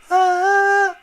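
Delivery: high falsetto with audible breath noise; breathy falsetto. Shown as a demonstration. Coach showing his own falsetto with breath mixed in, breathy and high. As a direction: let the air hiss through the head voice. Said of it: "息の音が混ざっちゃうんですよ".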